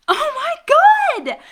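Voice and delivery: high, loud gasp of amazement; amazed shriek. Acted. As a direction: shriek in delighted shock. Can't reach around him.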